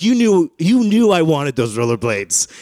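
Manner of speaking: high, loud, slow whine; whiny accusing kid. Retold or imitated. Son accuses his dad over the rollerblades, high whine. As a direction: complain like a wronged kid.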